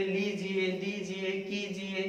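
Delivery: लीजिए, दीजिए, कीजिए with long ई then short इ; long first vowel. Shown as a demonstration. Teacher to class, drilling the long then short vowel. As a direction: stretch the first vowel, clip the second. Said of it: "पहली मात्रा बड़ी, दूसरी छोटी".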